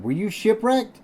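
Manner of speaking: high, rising question; astonished cry. A narrator's reading. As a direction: ask it amazed, pitch climbing right up. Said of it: "I cried".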